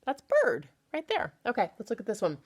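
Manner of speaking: usual speaking voice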